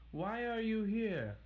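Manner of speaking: high, tense and loud; Little Red asks the wolf, high and uneasy; nervous high question. Acted. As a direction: ask the wolf warily. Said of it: "replied Little Red".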